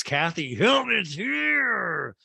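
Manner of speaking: high, very drawn-out announcement; mock fanfare. Recapper announces Kathy's grand entrance, mock fanfare. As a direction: herald the star with stretched, hammed-up words. Announcing a big guest's arrival.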